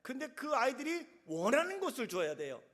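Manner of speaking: usual speaking voice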